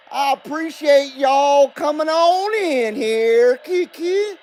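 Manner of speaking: put-on Southern accent, slow and sing-song. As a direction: play a folksy Southern good old boy. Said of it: "I was gonna do my show in an accent".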